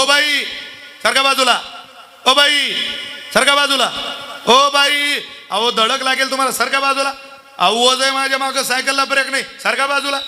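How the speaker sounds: high urgent shouted warning to clear the way; calling out warning. Retold or imitated. Cyclist with no brakes yelling at the woman to move. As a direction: yell at her to move aside.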